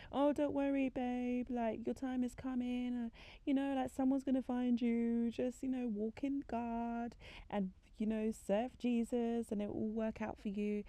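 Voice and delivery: high-pitched soothing patter; mock-sweet consoling; cliched reassurance. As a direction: comfort them with stock phrases, voice lifted. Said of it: "cliche things to say".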